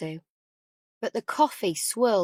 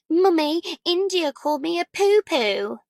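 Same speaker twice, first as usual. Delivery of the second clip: put-on high, louder tattling child; little girl telling on her twin; whiny child; 8 semitones higher, 4 dB louder than usual. A narrator's reading. Child complaining to her mother, whiny.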